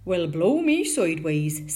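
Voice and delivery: loud rustic character voice; astonished tinker. A narrator's reading. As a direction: exclaim as a flabbergasted tinker. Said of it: "said the tinker".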